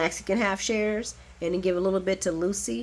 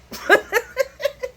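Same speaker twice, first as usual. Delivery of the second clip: high laugh; 3 dB louder than usual. Real, not acted. Laughing at Michael.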